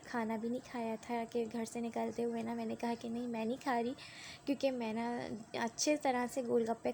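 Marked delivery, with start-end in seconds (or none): none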